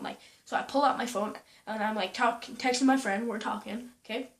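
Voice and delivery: normal talking voice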